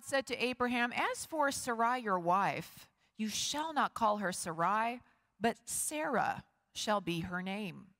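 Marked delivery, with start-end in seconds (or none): none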